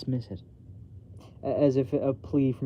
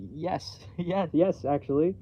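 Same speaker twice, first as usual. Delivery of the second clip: flustered laugh; 5 semitones higher, 3 dB louder, slower than usual. Acted.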